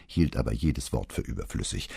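ordinary delivery

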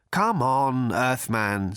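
mildly nervous urging. A narrator's reading. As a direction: urge him along, a touch anxious. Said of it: "said Slarty Bartfast nervously".

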